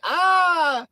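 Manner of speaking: high loud mock scream of a woman falling; mock shriek. Imitating the girl knocked over, high mock shriek.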